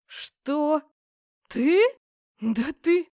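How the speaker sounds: loud, stunned splutter; shocked outburst. A narrator's reading. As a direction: spit out the words in disbelief. Cousin shocked by the news.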